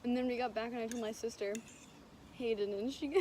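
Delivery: ordinary delivery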